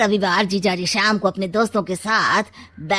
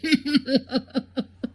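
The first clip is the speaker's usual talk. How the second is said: triumphant laugh; 5 semitones higher, 3 dB quieter than usual. Acted.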